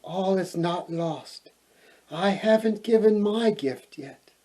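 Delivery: soft, small reassuring voice; tiny fairy voice. A narrator's reading. Smallest fairy reassuring the weeping queen, soft and small.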